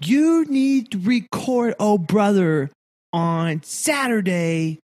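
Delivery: lightly voiced child quoting a parent; imitating Noah. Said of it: "he was like".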